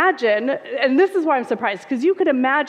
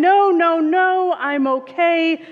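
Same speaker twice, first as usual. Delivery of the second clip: yelled over the wind, higher and louder; yelling; 6 semitones higher, 4 dB louder, slower than usual. Acted. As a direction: shout it over wind and rain, waving off the rescuer. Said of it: "the man yells again".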